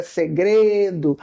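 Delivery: "Segredo" bem devagar, caindo no fim, com "gre" marcada; stress on syllable. Shown as a demonstration. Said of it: "qual é a sílaba tônica?". ("Segredo" lento, com tônica na penúltima)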